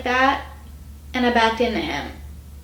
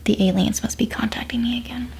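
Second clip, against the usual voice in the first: spooky whisper. Pretend. Joking about hearing a strange noise.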